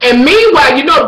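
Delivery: usual speaking voice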